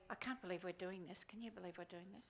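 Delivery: low, soft self-conscious grumble; soft low sheepish aside; embarrassed mutter. Retold or imitated. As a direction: mutter it to your neighbour, embarrassed. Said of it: "You are not a".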